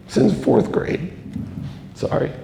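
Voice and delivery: fighting back tears, pitch breaking upward; real emotion catching in the throat; choked up. Real, not acted. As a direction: voice jumping high with emotion. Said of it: "I get choked up".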